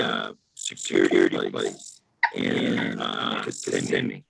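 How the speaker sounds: glitchy low robotic voice; distorted robot voice over a bad line. Real, not acted. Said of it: "Robot voice".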